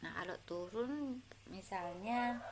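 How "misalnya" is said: ordinary delivery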